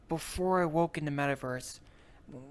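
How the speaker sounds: ordinary delivery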